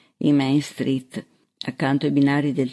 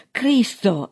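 higher-pitched startled oath; exclaiming; 7 semitones higher than usual. A narrator's reading. Gunman's captive to the sheriff, exclaiming. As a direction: exclaim it in alarm. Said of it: "esclamò".

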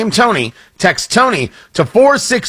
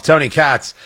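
ordinary delivery

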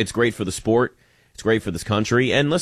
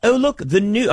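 put-on British accent, higher and chirpy; bad British accent; 7 semitones higher than usual. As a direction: start a quick British tourist impression. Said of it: "I'm just gonna do a British accent".

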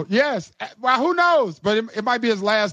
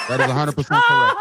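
laughing; 4 dB louder, faster than usual. Real, not acted. Laughing while confirming the answer.

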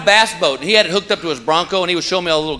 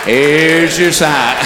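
slow drawn-out Here's your sign, your stressed; slow punchline; 2 semitones lower, 3 dB louder, much slower than usual. Acted. Comic to the audience, slow deadpan punchline. Recurring punchline delivered slowly.

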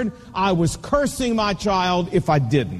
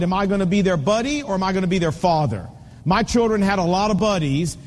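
ordinary talk

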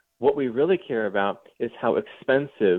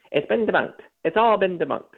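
mimicking a nasal reporter, pitched up; lightly mocking a smug little journalist; imitating the reporter's nasal insistence; nasally Politico man; 8 semitones higher than usual. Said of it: "And he repeats himself".

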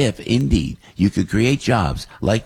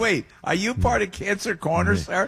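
imitating a fan's voice; 8 semitones higher, 4 dB quieter than usual. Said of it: "he does your voice".

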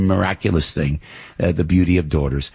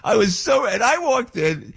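much higher, near shouting; excited shout. Real, not acted. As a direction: burst out with joy. Excited peak of the story.

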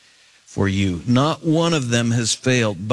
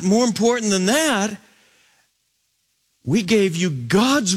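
much higher, urgent preaching push; fervent preaching; 9 semitones higher than usual. Acted. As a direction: drive the warning home from the pulpit. Voicing Peter's letter.

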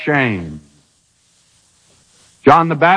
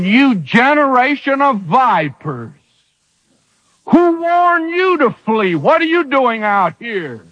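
preacher voicing John's fierce rebuke; thundering rebuke; 7 semitones higher than usual. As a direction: cry out against the vipers like a prophet. John rebukes the crowd as vipers.

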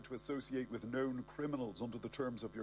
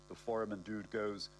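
ordinary delivery, nothing marked

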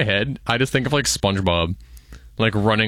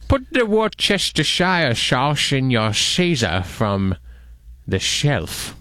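slow Scottish Sean Connery voice, "sauce" as "shauce"; lightly voiced Sean Connery, slow; Sean Connery impression; 3 semitones higher, much slower than usual. Co-host orders the sauce as Sean Connery, in a slow Scottish accent. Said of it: "Sean Connery".

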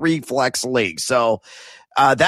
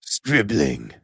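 movie trailer voice; 10 semitones lower than usual. Acted. Co-host joins the trailer bit.

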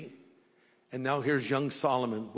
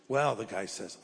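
ordinary delivery

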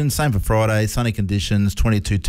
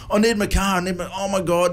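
higher-pitched mock fretting; imitated peaking panic; 8 semitones higher than usual. Son needing his car back, imitated panic. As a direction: imitate a son working himself up, pitched high. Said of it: "He was, like, peeking, going".

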